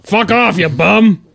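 loud high angry outburst; angry yell. Retold or imitated. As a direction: bark it out at the kid. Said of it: "And the kid's like, snaps".

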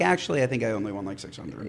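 fast, fading and quiet; deflated mutter. Retold or imitated. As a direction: let the air out, trailing away. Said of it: "I'm like".

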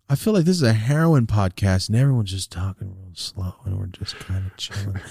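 normal talking voice

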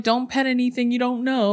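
chirpy ride-operator imitation; cast member voice. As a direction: mimic a theme-park cast member.

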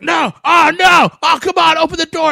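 Aaron Eckhart's frantic yell, hammed up; mock yelling. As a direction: scream at the shut door in a hammed-up panic. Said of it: "he's, like, yelling at".

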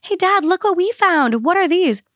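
put-on high, bright child asking dad; kid voice. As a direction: lightly play the curious kids. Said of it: "were like".

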